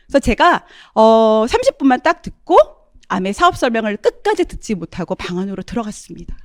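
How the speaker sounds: usual speaking voice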